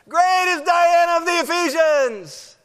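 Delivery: very high, slow, full-throated chant; mob shouting down. Acted. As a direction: drown the speaker out with a long yell. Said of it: "they just essentially shout him out".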